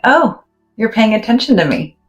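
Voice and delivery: a quick, bright, mock-surprised aside; voicing the brain. Guide voices the brain noticing attention, mock-delighted. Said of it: "your brain and your body going".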